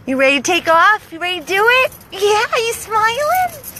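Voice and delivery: high, coaxing questions to the dog. Real, not acted. Rescuer coos at the dog before driving off. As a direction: coo at the dog.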